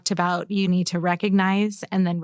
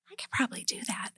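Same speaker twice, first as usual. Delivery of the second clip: breathy unvoiced whisper, much softer; whispered thought; 10 semitones higher, 11 dB quieter, much faster than usual. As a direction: whisper it to yourself like a private realization. Quiet thought voiced aloud.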